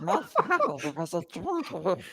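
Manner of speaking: Gollum voice. Pretend. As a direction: drag out Joker's scar line as Gollum. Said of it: "Tell us about those scars, Gollum".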